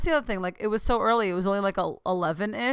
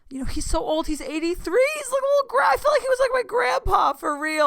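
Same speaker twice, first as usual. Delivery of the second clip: emotional gushing; 5 dB louder than usual. Real, not acted. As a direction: voice climbing to a very high squeal. Gushing that he is like grandpa.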